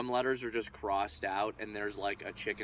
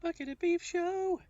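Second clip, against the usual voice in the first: squeaky falsetto echo; silly high voice. Pretend. Echoing co-host's show intro, very high silly voice.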